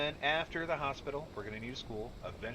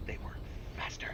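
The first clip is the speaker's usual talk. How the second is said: unvoiced, softer whisper; 5 dB quieter than usual. Real, not acted. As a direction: drop to a sly whisper. Whispered punchline of list.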